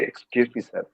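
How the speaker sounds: quiet, low, deferential excuse me; soft polite request. Shown as a demonstration. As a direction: ask permission humbly. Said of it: "and you say".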